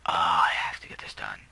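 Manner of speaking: loud, low groan of exasperation; exasperated groan. Acted. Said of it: "now I'm just like".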